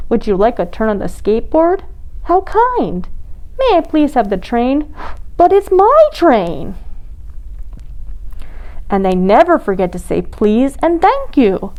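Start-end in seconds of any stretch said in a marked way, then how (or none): 2.2-6.8 high little penguin voice; penguin kid voice. Acted. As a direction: squeak it like a chick.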